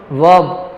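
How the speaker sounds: वर्ब as "vawb"; correct pronunciation. Shown as a demonstration. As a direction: model वर्ब with no R heard. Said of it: "एएनआर वाला sound आना चाहिए". (वर्ब में र की आवाज़ नहीं)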